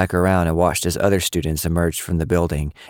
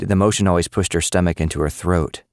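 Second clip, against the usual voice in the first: ordinary delivery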